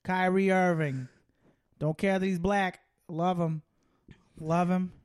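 deliberate Boston catchphrases; Boston accent. Acted. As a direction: play a Boston sports fan. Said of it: "that was my Boston accent".

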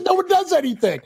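high voice through laughter; laughing. Real, not acted. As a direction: squeal it out laughing.

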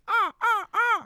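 very high, loud cawing crow; crow caw imitation. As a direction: caw like a crow startled at night. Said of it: "And the crow goes".

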